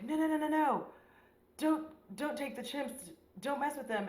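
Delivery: high-pitched mock pleading; pleading scientist. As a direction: play a scientist begging intruders to stop. Acts out scientist pleading with intruders.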